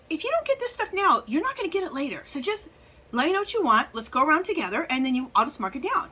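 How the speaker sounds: lightly voiced eager clerk, pitched well up; high voiced store clerk. Clerk insisting on marking down yarn, high voiced. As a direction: mimic the insistent shop lady, high and bright.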